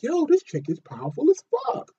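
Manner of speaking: high, excited exclamation of awe; amazed. Retold or imitated. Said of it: "I'm just sitting here like".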